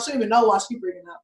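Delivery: ordinary delivery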